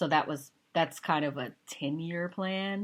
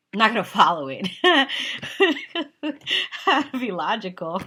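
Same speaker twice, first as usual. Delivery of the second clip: laughing; 6 semitones higher, 10 dB louder than usual. Real, not acted. Laughing joke about not following.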